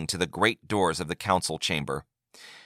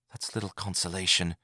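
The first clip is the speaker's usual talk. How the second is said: much softer dejected reply; 9 dB quieter than usual. A narrator's reading. Dejected Yagar answers the Advocate General softly. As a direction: say it with no hope.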